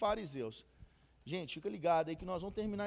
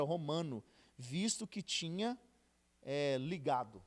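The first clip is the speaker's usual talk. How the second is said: slow, louder, measured Bible reading; scripture reading; 2 semitones lower, 6 dB louder than usual. Acted. Teacher reads Acts aloud to his study group, slow and deliberate. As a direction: read the verse out slowly for the group.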